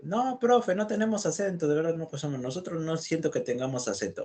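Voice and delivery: high-pitched imitation of a Lima student denying his accent; high mock student. Profesor imitando a un alumno limeño que niega su acento, con voz aguda. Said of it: "Hablamos rápido y demás".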